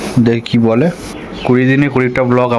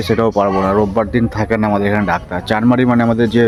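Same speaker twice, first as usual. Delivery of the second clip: ordinary talk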